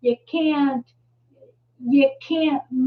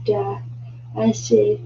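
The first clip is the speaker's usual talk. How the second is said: ordinary delivery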